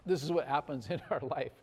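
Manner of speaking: ordinary delivery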